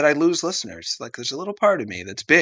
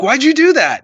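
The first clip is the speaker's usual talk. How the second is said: high, loud indignant question; mock outraged crowd; 8 dB louder than usual. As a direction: demand an answer, pitched right up. Crowd confronts the abortionist.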